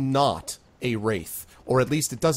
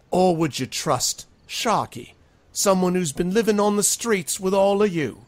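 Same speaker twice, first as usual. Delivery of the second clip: rabble-rousing speech; 8 semitones higher, 4 dB louder than usual. Acted. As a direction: goad the townsfolk to pick a side. Sharky continues his speech.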